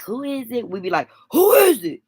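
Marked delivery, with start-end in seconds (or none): 1.3-2.0 loud, high-pitched tough challenge; toughened-up, booming door challenge; means-business holler. Shown as a demonstration. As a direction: holler through the door like you mean business. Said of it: "Let 'em know you mean business".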